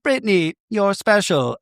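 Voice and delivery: high, cutesy toy voice; talking stuffed animal. Pretend. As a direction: voice a stuffed animal come to life. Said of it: "if these stuffed animals can talk".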